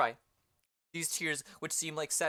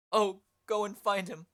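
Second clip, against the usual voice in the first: ordinary talk, nothing marked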